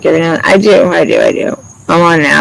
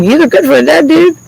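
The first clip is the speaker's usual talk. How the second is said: joking to a dead friend; 11 semitones higher than usual. Retold or imitated. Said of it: "I'm like".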